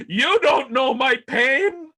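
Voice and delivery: put-on slow, loud, high creaky old man complaint. Son tells dad his woes like an old man, slow and loud. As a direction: drag it out like a weary ninety-seven-year-old. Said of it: "the most ninety-seven-year-old man thing".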